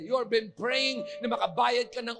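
loud, high revival preaching; full-force preaching. Acted. Pastor preaching at full force to the church. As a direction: fires up the congregation.

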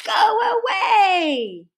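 high, slow, hammed-up crowd shout; locals shouting. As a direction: yell it like an angry crowd of locals. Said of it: "the locals would gather and shout".

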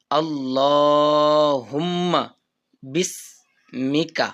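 drawn-out chanted dua; slow recitation. Shown as a demonstration. Teacher reciting for students to follow, slowly. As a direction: recite slowly, syllables stretched. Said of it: "আবার এইটুকু আমরা revise দিই।".

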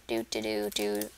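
low droning zombie hum. Pretend. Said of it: "Let's pretend that I'm a zombie".

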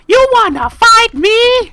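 very high, loud cartoon voice daring a fight; squeaky mock tough challenge. Sheriff dares another player to fight, in a squeaky cartoon voice. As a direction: squeak out the threat like a tiny tough guy.

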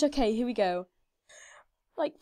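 ordinary talk, nothing marked

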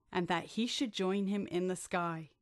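plain reading voice

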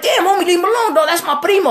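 high, loud, agitated plea; alarmed protest. Acted. Man begs friend to leave his primo alone, alarmed protest. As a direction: protest loudly to break up a fight. Pleading to stop a beating.

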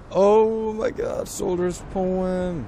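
high, slow, drawn-out delight; thrilled. Real, not acted. Gamer spotting a rare shop item, thrilled. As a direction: gush over the rare find.

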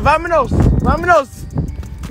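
high, punchy holler; calling out. Pretend. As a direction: call it out high, like rallying a crew. Calling the crew to move.

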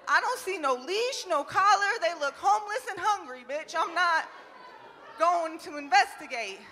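normal talking voice

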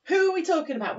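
dramatic gasp. Acted. Teacher gasps, dramatic question.

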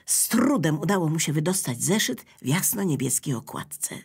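ordinary delivery, nothing marked

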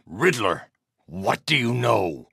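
slow menacing demand. Acted. Interrogating a thug.

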